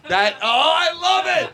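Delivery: high, delighted outburst. Real, not acted. Host seizing on a running bit, gleeful shout. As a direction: shout it with sudden glee.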